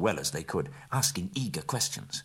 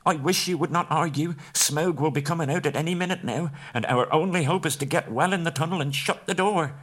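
much higher and louder, pleading hobbit; urgent Bilbo; 10 semitones higher, 4 dB louder than usual. A narrator's reading. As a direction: hurry them inside.

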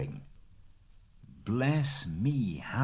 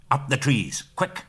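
louder, urgent command; urgent cry; 5 semitones higher, 5 dB louder than usual. A narrator's reading. Gandalf ordering everyone up the trees, urgent cry. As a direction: bark the order to climb. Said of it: "cried Gandalf".

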